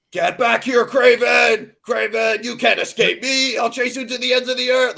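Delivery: high shouted cartoon hero yell; Christopher Daniel Barnes. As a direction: yell it like nineties cartoon Spider-Man. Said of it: "channeling, uh, Christopher Daniel Barnes going like".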